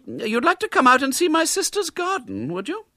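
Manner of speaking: high, prim lady's voice; a polite spinster forcing kindness. A narrator's reading. Miss Milton to the disguised boy, high and prim.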